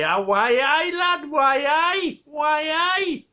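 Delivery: high, excited Geordie with laughs; Geordie accent. Retold or imitated. Geordie guy warns Victor about the snake, laughing Geordie accent. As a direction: Geordie lad breaking into giggles as he warns. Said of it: "the Geordie guy".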